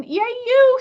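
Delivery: excited little celebration; genuine cheer. Shown as a demonstration. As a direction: cheer her on with real joy. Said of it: "in a genuine".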